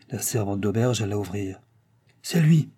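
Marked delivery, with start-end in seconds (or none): 2.2-2.7 high, louder note of dismay. A narrator's reading. Innkeeper realising the courier has arrived, dismayed. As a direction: blurt it with consternation. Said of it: "dit l'hôte consterné".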